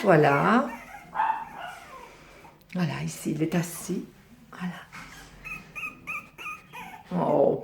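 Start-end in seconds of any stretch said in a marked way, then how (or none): 2.7-4.7 whisper. Real, not acted. Quiet near puppies.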